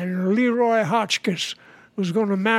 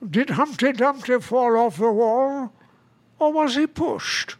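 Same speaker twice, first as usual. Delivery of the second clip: English accent; 3 semitones higher than usual. Shown as a demonstration. Actor showing off English dialects with a nursery question, English accent. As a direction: play it as a put-on English character. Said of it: "I love doing, uh, E-, English dialects".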